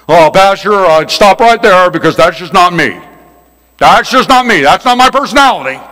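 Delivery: mock defensive complaint; objecting churchgoer. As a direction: play an indignant member interrupting the pastor. Voices an objecting church member.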